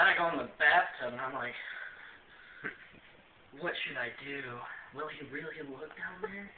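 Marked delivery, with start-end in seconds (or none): 3.6-6.5 soft, low thinking aloud; musing to himself. Retold or imitated. Man alone in the bathtub asking himself, quiet musing. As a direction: quietly wonder it to yourself.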